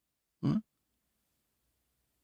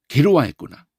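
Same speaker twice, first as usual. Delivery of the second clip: ordinary talk, nothing marked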